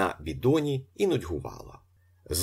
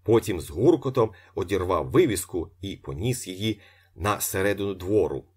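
ordinary delivery

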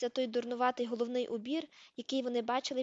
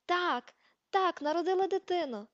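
louder and higher, overjoyed; happy shout; 7 semitones higher, 5 dB louder than usual. A narrator's reading. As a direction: shout it, beaming with joy. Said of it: "закричав Люпен".

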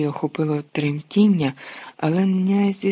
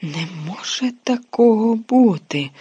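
high, slow, loud shocked cry; a girl crying out in disbelief; 6 semitones higher, 4 dB louder than usual. A narrator's reading. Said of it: "крикнула Герміона".

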